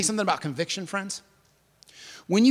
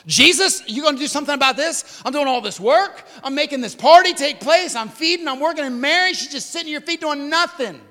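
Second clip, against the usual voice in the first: high, loud indignant outburst; exasperated complaint; 11 semitones higher, 9 dB louder than usual. Acted. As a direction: complain to Jesus about your idle sister, voice pitched way up. Voices Martha complaining to Jesus.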